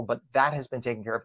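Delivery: usual speaking voice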